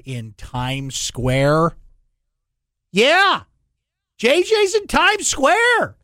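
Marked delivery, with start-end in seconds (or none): 2.9-6.0 high, loud whoop of celebration; hyped-up radio host hollering for a friend; excited cheer. Real, not acted. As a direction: shout it out high, stretching the words in triumph. Excitedly hyping friend's Times Square billboard.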